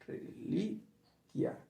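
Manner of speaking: Reliquia stretched slowly and softly, LI stressed; syllables drawn out. Shown as a demonstration. Teacher echoing the student's word slowly, stressing LI. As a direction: draw out Reliquia, re-LI-quia. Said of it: "reliquia podría ser grave".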